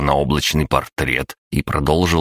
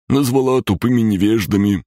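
high-pitched creature voice; high little creature owning up; confessing green creature; 7 semitones higher than usual. A narrator's reading. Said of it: "признался зелёный".

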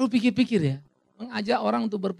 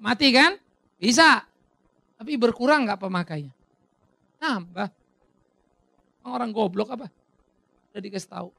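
ordinary talk, nothing marked

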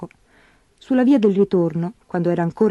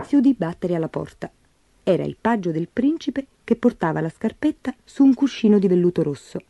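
usual speaking voice

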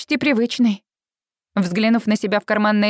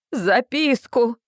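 dismayed; much slower than usual. Acted. Mother repeats in dismay.

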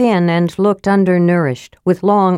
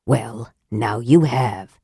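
low, slow and soft, icy; cold reply; 8 semitones lower, 4 dB quieter, slower than usual. A narrator's reading. Raistlin answering Flint coldly, low and slow. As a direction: let it drop like ice. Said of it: "Raistlin returned coldly".

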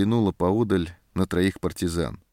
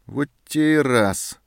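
slow, louder, irritated turn; angry; 6 semitones higher, 4 dB louder, slower than usual. A narrator's reading. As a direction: snap it out in annoyance. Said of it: "зло обернулся сержант".

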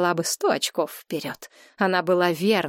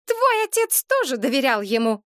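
higher, raised retort; shouting out; 7 semitones higher than usual. A narrator's reading. Mother crying out that the father trusted him too. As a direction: throw it back at her. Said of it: "выкрикнула".

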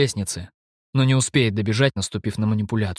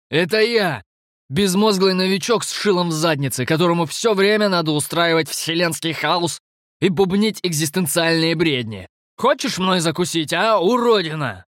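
taunting shout; 7 semitones higher than usual. Acted. Stef taunting the monster.

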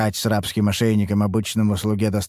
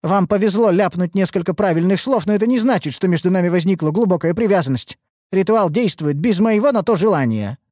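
high, heated rebuttal; exasperated, high-pitched argument; getting irritated; 9 semitones higher, 4 dB louder than usual. A narrator's reading. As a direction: insist with mounting annoyance. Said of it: "начала раздражаться Ульданте".

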